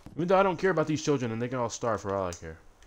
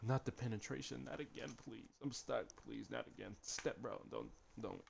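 very soft, helpless mock plea; hushed, hammed-up begging; mock pleading; 19 dB quieter than usual. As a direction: beg under your breath, playing the victim. Joking plea while stuck.

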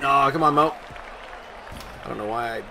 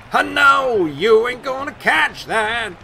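gleeful taunt; 8 semitones higher, 7 dB louder, slower than usual. Real, not acted. Taunting fielder.

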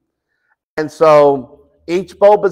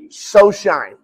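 normal talking voice

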